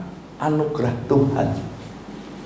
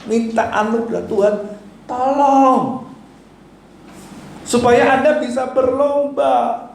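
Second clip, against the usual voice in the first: preaching at full force; 8 semitones higher than usual. Acted. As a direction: drive it home to the congregation. (khotbah berapi-api dengan suara tinggi)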